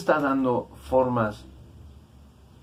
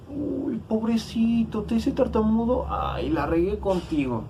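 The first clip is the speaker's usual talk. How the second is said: mocking pity; 11 semitones higher than usual. What God did not say to poor Moses, mocking pity. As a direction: coo it in a mock-pitying falsetto. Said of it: "Dios no le dijo".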